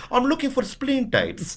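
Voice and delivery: mock earnest caller asking a shop; prank call character. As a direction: play the silly phone prankster, drawn out and high. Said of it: "getting this character to call up everywhere".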